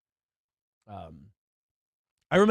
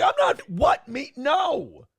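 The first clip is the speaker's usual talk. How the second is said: much higher, startled protest of innocence; high indignant denial; 9 semitones higher, slower than usual. Retold or imitated. Accused by the guy, he protests his innocence in a high startled voice. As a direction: squeak out a shocked denial. Denying accusation, retold reply.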